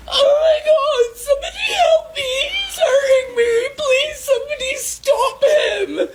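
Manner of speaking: shrieking high, sobbing cries for help; fake crying screams for help. Girl fakes being attacked, screaming and crying for help. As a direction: scream and sob like you're the victim, playing it up.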